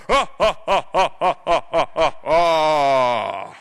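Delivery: slow, spaced "ha" villain laugh; evil royal laugh. Acted. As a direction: laugh wickedly, falling to a long final ha. Acting the king's villainous laugh.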